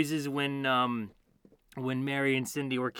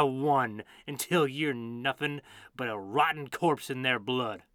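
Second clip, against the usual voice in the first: menacing villain; 6 dB louder than usual. Acted. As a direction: snarl a cold condemnation. Villain speech in recording.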